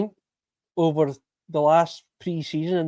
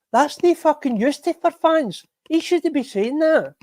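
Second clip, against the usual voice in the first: much higher, exasperated rant; getting angry, voice rising; 11 semitones higher than usual. Real, not acted. Fan ranting to his chat about the manager, pitched way up in anger. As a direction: let the anger push the pitch way up. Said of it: "my voice is getting higher".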